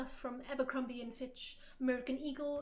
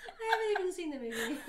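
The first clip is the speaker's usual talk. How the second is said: fast, loud, higher, through laughter; real amused disbelief, laughing; laughing protest; 5 semitones higher, 6 dB louder, faster than usual. Real, not acted. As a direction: protest while cracking up. Laughing along after the remark.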